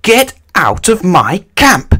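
high, loud, slow command; roaring back. A narrator's reading. Little Miss Bossy ordering the bear out, roaring. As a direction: bellow each word to drive it off. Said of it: "little Miss Bossy roared back".

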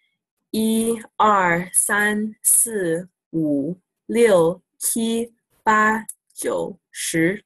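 counting one to ten in Mandarin, carefully; requested Mandarin count demo; counting in Chinese. Shown as a demonstration. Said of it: "I can count to ten in Chinese for you".

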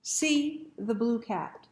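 ordinary delivery, nothing marked

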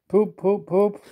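three very high toots; engine whistle. Acted. Engines signal before the rescue pull, tooting. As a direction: toot crisply like a train whistle.

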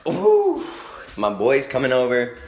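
loud, high, thrilled; excited. Real, not acted. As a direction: gush with excitement. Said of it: "I'm so excited".

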